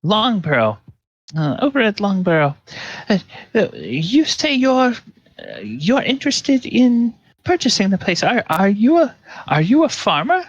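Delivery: farm wife voice. Acted. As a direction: prattle sweetly like a farm wife. Wife Mira continues.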